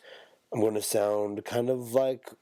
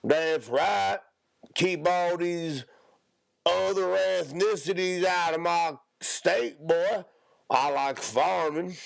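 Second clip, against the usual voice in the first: exaggerated hillbilly accent, pitched up; 8 semitones higher, slower than usual.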